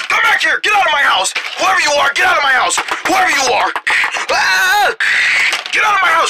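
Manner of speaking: high, exclaimed chase shouts; mock outraged yells at an intruder; play-acted angry yelling. Homeowner chases criminal out of house, mock angry yelling.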